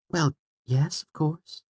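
high, soft female character voice; soft, lifted feminine reply; young woman's voice. A narrator's reading. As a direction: answer softly in a high woman's voice. Narrator voices Fela answering.

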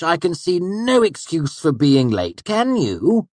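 high, loud, mocking master; sneering Hemme. A narrator's reading. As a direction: condescend with cruel sarcasm. Hemme mocks Basil.

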